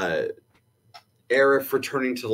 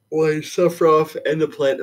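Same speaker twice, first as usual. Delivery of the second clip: words stretched by a yawn, higher and louder; 5 semitones higher, 5 dB louder than usual. Real, not acted. Co-host apologizing mid-discussion, yawning. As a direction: talk through a real yawn. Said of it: "sorry, I'm yawning".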